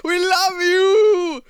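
long, high, loud cheer; drawn-out loving holler; mock adoring call. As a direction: call it out, stretching every word. Joking shout-out to someone.

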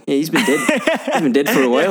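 playful Korg impression. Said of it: "He's like".

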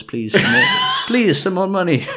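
high, loud begging through laughter; laughing mock plea. Podcaster jokingly begging listeners for money, high laughing plea. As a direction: beg jokingly, laughing, pitch shooting up.

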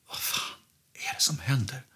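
whisper. Retold or imitated. Panicked self-quote during psychosis.

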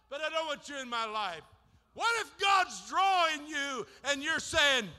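high, loud revival preaching that climbs into shouts; fiery preaching. Acted. Preacher to the congregation, full-force shouting sermon. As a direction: preach it hard and lift the room.

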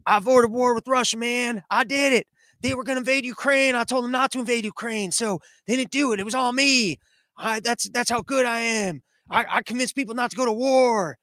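a hint of a smug president taking credit; mock Biden boast. Biden bragging at a debate, lightly mocking voice. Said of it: "show up to one of these debates and go".

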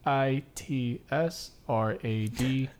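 slow, letter-by-letter spelling. Real, not acted. Co-host giving his social handle to listeners, spelling it out. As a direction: spell each letter out slowly and separately. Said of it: "Every time he starts spelling it out".

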